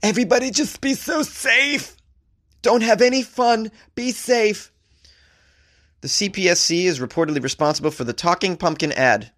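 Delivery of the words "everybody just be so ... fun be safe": high, slow mocking nanny; sing-song mock scolding, much higher. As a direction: parody a fussy safety official. Mocking overprotective government.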